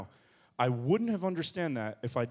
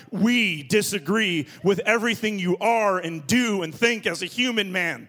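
louder, higher declaration of protest; mock church uproar; 4 semitones higher, 7 dB louder than usual. Pastor voicing the imagined congregation, loud and higher. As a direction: boom it like the whole church objecting.

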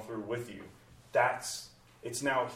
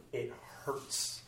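breathy whispered confession; 8 dB quieter than usual. Real, not acted. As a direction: whisper the pain. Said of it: "naming grief".

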